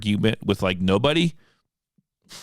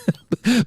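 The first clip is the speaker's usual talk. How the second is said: laugh; 13 semitones higher, 3 dB louder than usual. Real, not acted. Laughs at own outburst.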